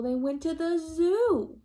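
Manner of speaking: zoo drawn out, slow, high and loud; stressed punchline. A narrator's reading. Teacher landing the joke for the class, slow and loud. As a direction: land the word zoo slowly and high.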